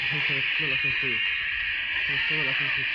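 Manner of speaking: loud, repeated yell. Real, not acted. Yelling repeatedly while playing. (صراخ عالي متكرر)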